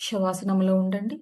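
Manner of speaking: calm, unhurried meditation guide; soothing yoga nidra guidance; guided meditation. Acted. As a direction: ease listeners into stillness.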